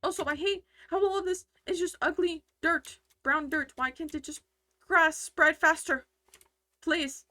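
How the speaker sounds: frustrated outburst. Real, not acted. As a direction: vent about the ugly dirt. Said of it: "Sorry, I get really passionate about this".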